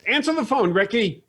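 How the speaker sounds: high, nagging demand; Ricky's mother. Acted. Mother demands that Ricky answer her, nagging. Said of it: "another voice comes on there".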